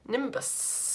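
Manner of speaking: soft nimbus with a long sustained sss; long running-water hiss. Shown as a demonstration. Teacher making listeners need the toilet, long hissed nimbus. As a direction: hiss the end like pouring rain. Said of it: "try and make anyone in the room with you need the toilet".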